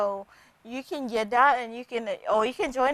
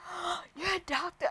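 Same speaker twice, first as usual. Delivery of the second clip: impressed patient; 7 semitones higher, 8 dB quieter than usual. Retold or imitated. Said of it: "and he said".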